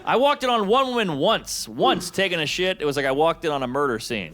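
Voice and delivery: ordinary delivery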